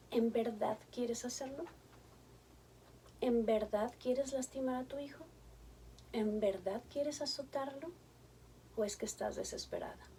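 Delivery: soft questions fading to a whisper; gentle questioning. Acted. Therapist softly asks the angry mother, fading to a whisper. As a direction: ask softly, coaxing her to stop.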